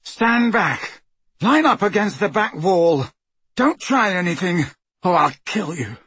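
nervous threat, starting high; shaking voice. A narrator's reading. Frightened guard ordering prisoners back from the door, shaking voice. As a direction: call out orders, trying to sound threatening. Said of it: "Draco's shaking voice spoke from behind the door".

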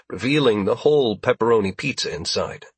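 plain reading voice

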